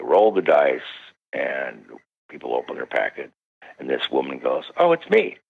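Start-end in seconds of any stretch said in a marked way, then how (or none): 4.8-5.4 high, light mock surprise; a startled little "oh, it's me". Woman realizing she was picked, imitated in mild surprise. Woman finds her number called.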